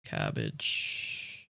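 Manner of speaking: breathy whisper on cabbage; a sneaky whisper; whispered sneaky word. Acted. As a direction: slip the word cabbage in under your breath.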